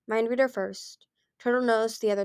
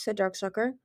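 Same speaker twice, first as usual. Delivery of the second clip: plain reading voice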